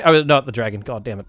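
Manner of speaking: ordinary delivery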